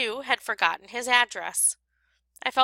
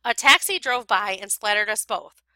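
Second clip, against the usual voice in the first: plain reading voice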